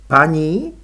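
sneering, aloof. A narrator's reading. Růžena scoffs at being called madam, aloof sneer. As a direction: toss back Paní with cool disdain. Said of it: "ušklíbla se odměřeně".

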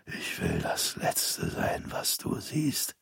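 slow, soft dying rasp; rasping whisper. A narrator's reading. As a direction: pull him closer with your last breath. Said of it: "wiederholte Opa". (langsames, heiseres Sterbeflüstern)

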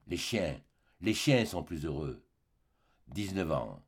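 bas et lourd, presque éteint; amer, accablé. A narrator's reading. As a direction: laisser tomber les mots dans la douleur. Le forçat évoque le bagne. (amertume sourde, voix basse)

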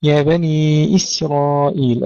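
slow recitation, thin light S in إسرائيل; drawn-out vowels, the S of إسرائيل kept thin; light sin. Shown as a demonstration. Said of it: "السين دائمًا ننتبه إلى أنه مرققة".

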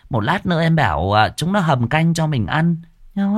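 high, tart, teasing jab; sharp-tongued sass. Acted. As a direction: needle her rival with sugary spite. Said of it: "lại dùng giọng chanh chua lắc lẻo".

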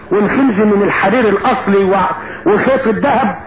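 plain reading voice

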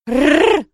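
loud rolled-r growl; dragon growl. Acted. Baby dragon Marsi about to spit fire, growling. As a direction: trill a little dragon's snarl. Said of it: "зарычала Марси".

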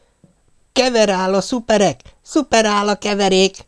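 high, hurried shout of a jumbled formula; feverish yelling. A narrator's reading. Pop yelling the mixed-up formula while loading rockets, excited shout. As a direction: yell it out in breathless excitement. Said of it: "ordította lázas sietséggel".

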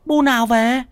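high-pitched, anxious wife voice; fretful wife. Acted. Wife pestering her trembling husband, high and anxious. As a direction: press him with a high, worried nag.

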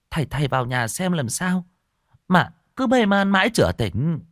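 high, lightly voiced village wife; worried wife. A narrator's reading. Wife asks the master to check her husband, a touch anxious. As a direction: ask the master anxiously for help.

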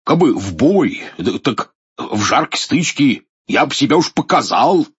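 high boastful voice of a nervous soldier; blustering soldier. Acted. Воевода храбрится перед министром. (хвастливый трусливый вояка)

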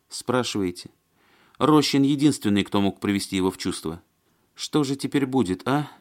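high-voiced, fretful woman character; Маня. A narrator's reading. Woman lamenting the loss of Рощин, fretful.

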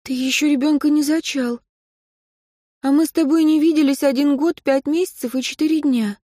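a hint of a hurt young woman; Katya, a young woman. A narrator's reading.

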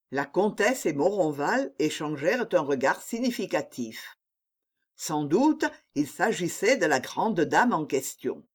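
plain reading voice

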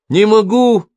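put-on high, loud child's wail; acted crying of a little boy; tearful protest. A narrator's reading. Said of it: "заплакал Китька". (плаксивый детский протест)

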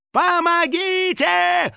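very high, long drawn-out yell; crying for help. A narrator's reading. House spirit yelling from the bike, crying for help. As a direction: stretch it into a desperate scream. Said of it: "крик своего друга".

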